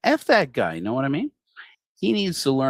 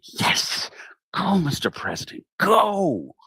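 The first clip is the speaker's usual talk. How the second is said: excited cheering; 9 semitones higher, slower than usual. Real, not acted. Comedian cheering the president for the telescope, excited laughing shout. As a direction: cheer him on, building to a laughing high shout. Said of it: "I get emotional, I get excited".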